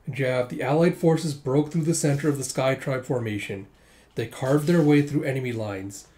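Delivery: plain reading voice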